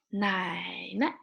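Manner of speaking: "Nai ne" with a long dipping "Nai", short "ne"; "Nai ne" with a toneless, clipped last syllable; neutral tone ending. Shown as a demonstration. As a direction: draw out "Nai", then drop a quick "ne". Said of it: "So short".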